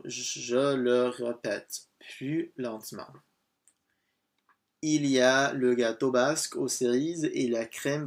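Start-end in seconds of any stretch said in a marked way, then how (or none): 0.0-3.1 very slow, careful French model sentence; slowed-down demonstration. Shown as a demonstration. French teacher repeating the phrase for a learner, slowed right down. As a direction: sound it out word by word, stretched.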